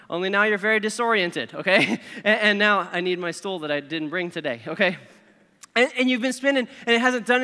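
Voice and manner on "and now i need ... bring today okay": ordinary talk, nothing marked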